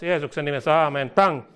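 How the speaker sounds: ordinary talk, nothing marked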